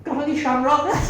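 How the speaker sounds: spring the punchline high and loud; cheeky leprechaun. Acted. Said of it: "she's playing the part of a leprechaun".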